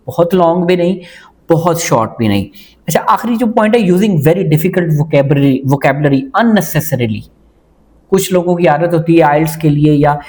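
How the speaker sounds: normal talking voice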